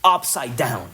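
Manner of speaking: upside down said slowly in English; English words. Shown as a demonstration. Preacher quoting the English Bible, upside down said in English. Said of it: "King James Version".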